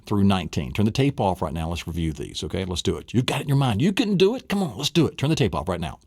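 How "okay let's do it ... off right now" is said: ordinary talk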